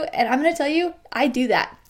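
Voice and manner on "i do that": usual speaking voice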